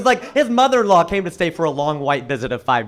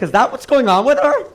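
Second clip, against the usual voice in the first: louder, higher amused shock; mock surprise; 6 semitones higher, 4 dB louder than usual. Husband at a party, mock-surprised at wife. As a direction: act stunned at a party. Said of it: "I'm like".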